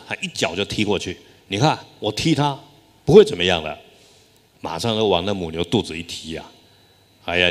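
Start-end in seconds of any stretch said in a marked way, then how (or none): 1.5-3.8 rising to a louder brash boast on the last words; dismissive, then loud and cocky. Retold or imitated. Woman boasts as she kicks cow. (满不在乎地大声炫耀)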